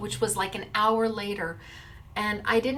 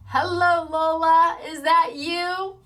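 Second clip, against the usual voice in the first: higher, louder, slowed call; sing-song greeting; 6 semitones higher, 4 dB louder than usual. Real, not acted. Vlogger greeting the second winner, higher and louder. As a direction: call out to her playfully.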